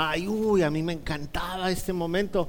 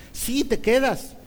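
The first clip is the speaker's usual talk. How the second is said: higher, slow, firm mock-mom insistence; insistent mom; 8 semitones higher, 4 dB louder, slower than usual. Mom insists to her protesting son, stern. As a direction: draw out a mother's final word. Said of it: "mi mamá me dijo".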